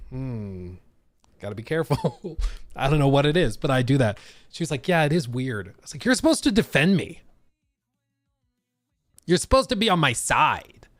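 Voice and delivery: ordinary talk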